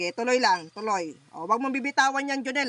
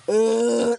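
loud groaning grunts; 9 dB louder than usual. Real, not acted. Healer grunting loudly over the patient in trance. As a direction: push out each grunt from the chest.

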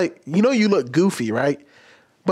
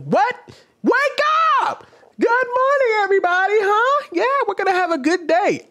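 hammed-up trainer, much higher, shrill wake-up call; fake excitement. Shown as a demonstration. Trainer forcing fake excitement on grown staff, much higher hammed-up wake-up call. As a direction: overdo the peppy morning greeting. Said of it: "And it's like".

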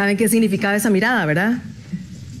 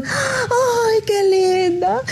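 much higher, sugary teacher voice; sweet old teacher; 9 semitones higher, much slower than usual. Retold or imitated. The old teacher greets her former pupil, high and sugary. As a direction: coo like a doting schoolteacher.

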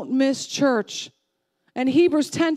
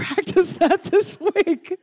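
normal talking voice

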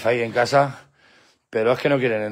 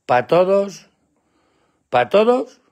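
high, questioning lilt to a dog; coaxing pet talk; 6 semitones higher, 5 dB louder, much slower than usual. Real, not acted. Owner asking his dog for an I love you, coaxing. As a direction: pitch it up to prompt the pet.

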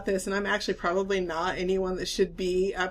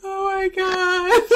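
laughing; 8 semitones higher, 3 dB louder, slower than usual. Real, not acted. Laughing fit over bra story.

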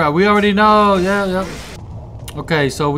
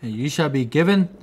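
reading an inscription; 5 semitones lower, 7 dB quieter than usual. Acted. Player reading the ship inscription aloud, hushed and solemn. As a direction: read it like an old carved warning.

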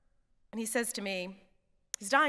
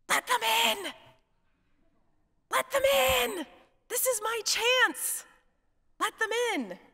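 much higher, louder yelled plea, slow on chance; shouting from inside; 12 semitones higher, 4 dB louder, slower than usual. Retold or imitated. Sick Jim yelling from inside the house to let the crew in, shouting. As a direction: call out desperately so they hear you through the house.

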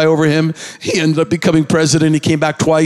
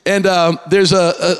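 ordinary talk, nothing marked

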